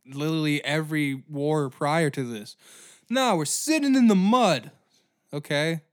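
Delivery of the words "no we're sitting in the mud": mocking. Mocking trench soldiers.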